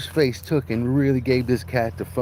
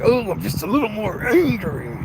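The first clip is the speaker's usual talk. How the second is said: mock angry; 8 semitones higher than usual. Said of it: "It's like".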